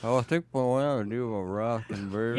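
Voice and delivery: toothless mumble, lips over gums; lips over teeth. Pretend. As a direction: talk like you have no teeth. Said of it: "talk as if you have no teeth".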